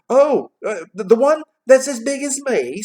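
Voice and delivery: high, loud, stammering lad; eager boy. A narrator's reading. Boy asking about the turkey, high and eager. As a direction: stumble in, then pipe up loudly.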